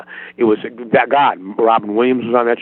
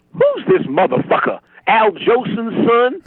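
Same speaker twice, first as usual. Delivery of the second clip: high, slow incredulous outburst; imitating an outraged Richard Pryor; Richard Pryor impression; 11 semitones higher, slower than usual. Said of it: "Richard turns to Paul and goes".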